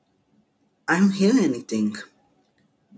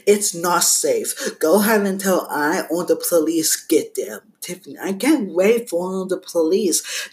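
usual speaking voice

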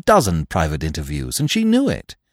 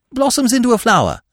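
fast, high, rushed ending; rapid finish; 5 dB louder, faster than usual. A narrator's reading. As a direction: rattle it off quickly. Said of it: "concluded Freddy rapidly".

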